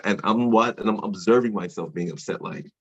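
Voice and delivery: usual speaking voice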